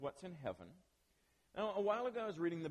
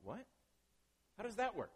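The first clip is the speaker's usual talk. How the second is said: high-pitched baffled question; mildly incredulous, voice lifting; puzzled; 6 semitones higher than usual. Retold or imitated. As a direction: retell being thrown by what you read. Retelling his puzzlement at verse.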